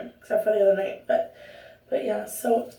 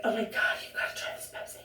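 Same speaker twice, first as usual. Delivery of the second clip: breathy hushed whisper of excited friends; hushed friend hype; 11 dB quieter, faster than usual. Retold or imitated. Friends urging her to try Pepsi, hushed whisper. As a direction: whisper it like a secret tip.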